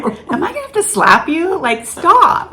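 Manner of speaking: high, loud mock exasperation; husband teasing his jumpy wife; husband exasperated. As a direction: tease her like a fed-up husband. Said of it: "he'd be like".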